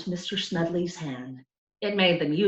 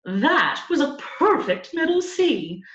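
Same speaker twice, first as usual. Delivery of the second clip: higher, slow, delighted praise of the chirp; delighted exclaim; 9 semitones higher, 5 dB louder than usual. A narrator's reading. Music teacher praising the cricket's note, delighted and slow. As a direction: savour each word with delight. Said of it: "exclaimed with delight".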